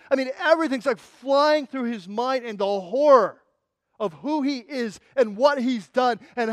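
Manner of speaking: loud, driving sermon storytelling; impassioned preaching. Real, not acted. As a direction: preach it at full force, piling phrase on phrase. Preaches at full force.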